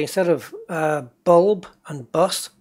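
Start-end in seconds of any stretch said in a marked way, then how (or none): 1.2-2.5 bulb and bus with northern vowels, bulb and bus like booolb and booz; northeast accent. Shown as a demonstration. Remembering his old accent, northeast English bulb and bus. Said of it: "'Cause I used to say".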